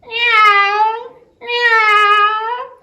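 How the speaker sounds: high, loud mewing like a hungry cat; cat-like bird call; catbird mewing. Acted. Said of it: "sounds like a hungry cat".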